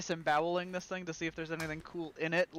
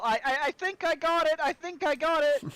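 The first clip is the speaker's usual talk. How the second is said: high, loud triumphant shout; excited yell; 10 semitones higher, 6 dB louder than usual. Acted. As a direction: call it out from the surface, pitched way up. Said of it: "and I'll yell".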